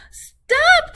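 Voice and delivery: high, loud shout; old couple shouting stop; calling out stop. A narrator's reading. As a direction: yell after the runaway. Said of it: "called the old woman and the old man".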